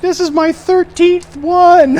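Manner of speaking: put-on very high, loud, slow teen wail; teen confessing to the officer, hammed-up wail; imitating the crying girl. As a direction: whine it out like a tearful teenager. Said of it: "She's like".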